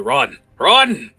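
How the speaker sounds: loud, high shouted command; urgent shout. Acted. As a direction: send them sprinting with an urgent yell. Fallon urges kobold to run.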